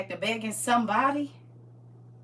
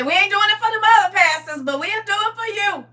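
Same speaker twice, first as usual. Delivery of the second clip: high, loud, fast mock quote; squeaky put-on voice of the flock; high mock voice of the people; 9 semitones higher, 9 dB louder, faster than usual. Scene: quoting people who'll do it just for her, in a high, loud mock voice. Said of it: "They say".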